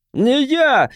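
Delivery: high, desperate cry; shouting. A narrator's reading. Hunted man shouting at the advancing crowd, desperate. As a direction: shout your innocence in panic. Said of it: "закричал он надвигающейся толпе".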